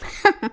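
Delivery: bursts into a high, amused laugh; laughing at his earnestness; loud laugh. Acted. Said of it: "చందన పెద్దగా నవ్వటం మొదలుపెట్టింది". (ఆనంద్ ఆవేశం చూసి చందన పెద్దగా నవ్వు)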